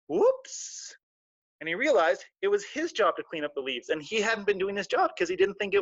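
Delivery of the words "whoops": sheepish whoops. Acted. Realizing own mistake.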